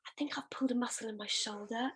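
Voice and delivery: soft unvoiced whisper of a sheepish husband; hushed, guilty whisper to his wife in labour; imitating a whisper. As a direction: whisper it like a husband who knows he shouldn't complain. Husband quoted mid-labour, whispered.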